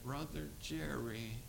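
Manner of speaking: very soft, slow, dazed imitation; Carmelo. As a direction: drag the words out as a drug-damaged boy. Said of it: "he talked like that".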